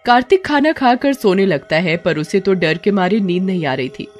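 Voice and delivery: ordinary delivery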